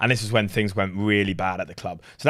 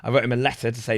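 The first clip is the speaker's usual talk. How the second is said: ordinary talk, nothing marked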